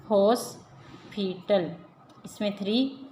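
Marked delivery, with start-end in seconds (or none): 0.1-1.7 hospital slowly, as हॉस, पिटल; split into syllables. Shown as a demonstration. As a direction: pause after हॉस in hospital. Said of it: "इनके syllable हिज्जे count".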